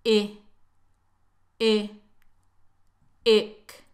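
"E" as a short lax ih, then "ick"; isolated relaxed ih, ending in "ick"; short relaxed vowel. Shown as a demonstration. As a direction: sound out a short, loose ih in "E, e, ick". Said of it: "es un sonido corto y relajado".

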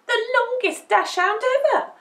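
high, loud and thrilled; bursting with excitement; excited puppy. A narrator's reading. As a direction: proclaim it with puppyish glee. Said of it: "cried Dumpling excitedly".

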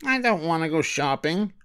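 put-on a grumpy little boy, lightly done; mild growl. Harry refuses shopping, mild grumbling child voice. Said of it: "growled Harry".

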